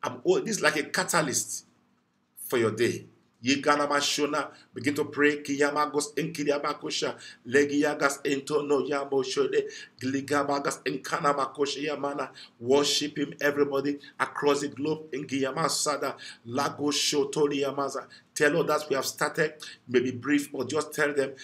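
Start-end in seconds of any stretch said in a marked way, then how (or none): 3.4-12.3 soft fervent glossolalia; praying in tongues. Real, not acted. Preacher praying in tongues over listeners' day. 15.1-18.1 soft fervent glossolalia; praying in tongues. Real, not acted. Preacher praying in tongues for viewers.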